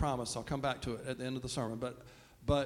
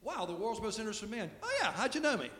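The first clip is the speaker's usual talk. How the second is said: high, lightly voiced vain man; mock smug celebrity; 6 semitones higher, faster than usual. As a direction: preen like the world's most interesting man. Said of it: "it's like saying".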